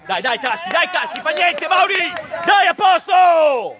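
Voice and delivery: loud encouragement building to a long yell; urging shout. Real, not acted. Player urges Taschi and Mauri on, shouting. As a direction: spur teammates on at full voice.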